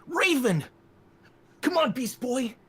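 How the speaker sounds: put-on rally the team like a young superhero leader; Robin from Teen Titans. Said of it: "But he's based off, um, uh, Robin from Teen Titans".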